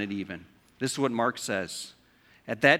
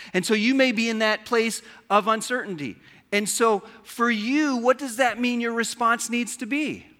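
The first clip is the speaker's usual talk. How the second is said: higher, louder, pressing appeal; preaching at full force; 8 semitones higher, 4 dB louder than usual. Real, not acted. Pastor urging the uncertain congregation to believe first, preaching at full force. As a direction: preach it hard to the room, pitched up.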